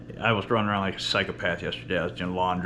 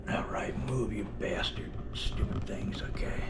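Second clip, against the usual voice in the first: whispering; 8 dB quieter than usual. Real, not acted. Muttering at objects while setting up.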